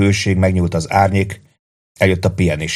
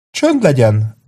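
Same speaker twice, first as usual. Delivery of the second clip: sharp; 5 semitones higher, slower than usual. Acted. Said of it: "szólt rá élesen Hall".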